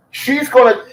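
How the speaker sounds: normal talking voice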